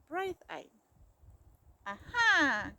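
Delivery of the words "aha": loud triumphant aha; spring out gleeful. A narrator's reading. Mouse popping from cake, loud gleeful aha. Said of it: "cried Mouse".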